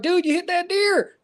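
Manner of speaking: high mocking buddy voice; high teasing buddy impression; buddies' voice. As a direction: squeak it out like a teasing friend. Buddies quoted laughing at him.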